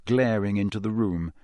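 plain reading voice

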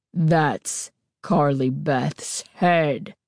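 put-on a masked girl's slow creepy rasp; slow deep rasp. A narrator's reading. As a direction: drag each word out, menacing. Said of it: "she repeated slowly".

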